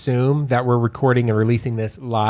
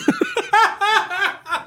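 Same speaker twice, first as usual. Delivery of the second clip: high, helpless burst of laughter; big laugh. Real, not acted. Host amused by own boast, laughing hard. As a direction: crack up at your own boast.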